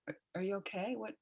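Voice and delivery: soft, worried bedside question; hushed concern. Retold or imitated. Brother softly asks the crying patient if he's okay. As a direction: ask it gently under your breath.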